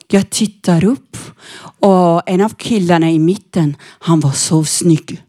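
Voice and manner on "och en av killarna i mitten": ordinary talk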